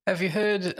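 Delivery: ordinary delivery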